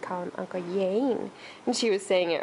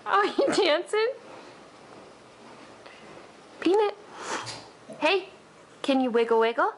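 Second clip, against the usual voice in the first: put-on high, louder, bouncy baby talk; excited baby talk; 8 semitones higher, 5 dB louder than usual. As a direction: cheer the baby on to dance. Playing with dancing baby.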